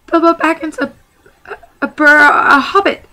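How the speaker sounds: high, loud, stammering in fright; frightened stammer. Acted. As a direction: stumble over the words, shaking with fear. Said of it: "said poor Bilbo, shaking all over".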